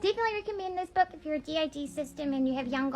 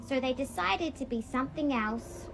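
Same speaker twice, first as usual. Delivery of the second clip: gentle storybook reading voice; reading to children. A narrator's reading. Reading a picture book to younger alters, gently. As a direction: read it like a bedtime book.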